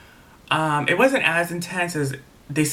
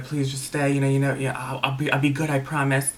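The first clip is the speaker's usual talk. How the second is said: normal talking voice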